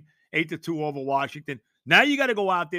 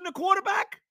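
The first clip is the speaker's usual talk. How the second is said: much higher, laughing through it; 13 semitones higher than usual. Real, not acted. Host joking about Zach Wilson at quarterback, laughing. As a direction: crack up at the joke.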